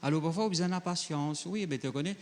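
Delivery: usual speaking voice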